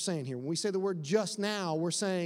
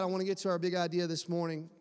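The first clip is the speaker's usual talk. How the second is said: usual speaking voice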